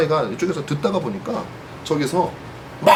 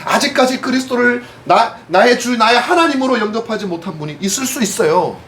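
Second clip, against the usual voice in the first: raised, pressing sermon voice; earnest preaching; 8 semitones higher, 3 dB louder than usual. Acted. Pastor urging his congregation, fervent preaching. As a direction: drive the point home to the pews.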